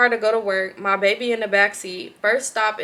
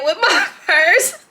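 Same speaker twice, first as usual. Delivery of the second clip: laughing; 8 semitones higher, 5 dB louder, slower than usual. Real, not acted. Reading the fight, laughing.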